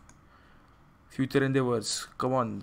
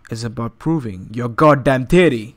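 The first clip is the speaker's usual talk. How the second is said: loud punchline; 9 dB louder than usual. Acted. Punchline of the mock wisdom.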